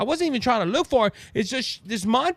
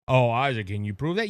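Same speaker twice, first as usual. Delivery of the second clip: skeptical listener; 9 semitones lower than usual. Retold or imitated. Voicing a doubting listener.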